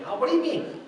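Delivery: high-pitched puzzled question; puzzled questioner. Retold or imitated. People asking a foster parent what he means, puzzled. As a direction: ask it high, as if baffled. Others question him, acted.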